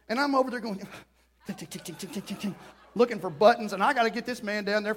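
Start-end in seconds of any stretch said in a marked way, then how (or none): 1.5-2.5 soft mouth clicking; quiet ticking sound effect; clicking noise. Retold or imitated. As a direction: mimic fiddling with buttons. Fumbling with bed buttons.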